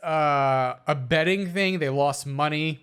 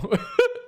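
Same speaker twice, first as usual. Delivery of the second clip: high laugh that catches the breath; choking laugh. Real, not acted. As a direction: laugh then choke on air.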